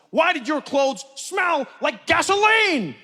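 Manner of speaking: slow, high, mounting courtroom shout; accusing prosecutor. Acted. Prosecutor cornering Mister Michaels, accusing shout. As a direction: hammer the question at the accused.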